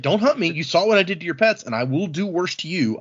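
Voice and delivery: ordinary talk, nothing marked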